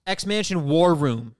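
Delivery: slow, loud title call; announcer voice. Acted. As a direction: boom the segment title slowly.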